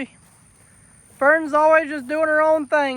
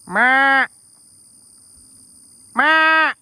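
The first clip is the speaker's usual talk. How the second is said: calling out; 8 dB louder than usual. Retold or imitated. Calling out imitating deer.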